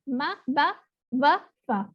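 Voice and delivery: ماں با وفا said slowly, word by word; slow memory phrase. Shown as a demonstration. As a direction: spell out the mnemonic slowly. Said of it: "اس کو اس طرح سے آپ یاد رکھ سکتے ہیں".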